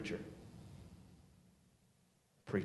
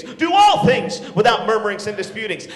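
shouted verse; 6 dB louder than usual. Acted. Preacher to teenagers, shouting the verse.

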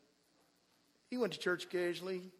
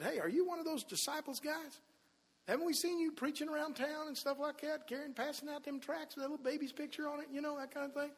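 higher, soft nosy questioner; questioning bystanders; 8 semitones higher, 4 dB quieter than usual. Retold or imitated. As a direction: play a bystander quizzing a suspect, pitched up and quiet. Accusing bystanders quoted in the story.

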